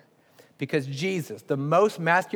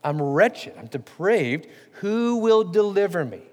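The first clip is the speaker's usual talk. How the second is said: emphatic plea rising to a drawn-out question; emotional plea; 3 semitones higher, slower than usual. Acted. Preacher re-voices Paul's emotional statement, emphatic rising plea. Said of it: "Now that's an emotional statement".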